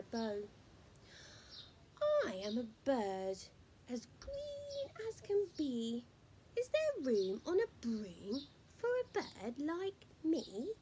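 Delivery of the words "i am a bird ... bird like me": chirpy bird asking politely. Acted. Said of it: "cried the witch".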